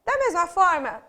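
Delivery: ordinary talk, nothing marked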